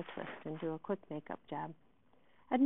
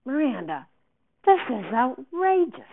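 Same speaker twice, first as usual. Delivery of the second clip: furious; 7 semitones higher, 11 dB louder than usual. A narrator's reading. Director to Miranda backing out, furious. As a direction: come down hard, scolding a student. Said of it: "Davenport looked furious".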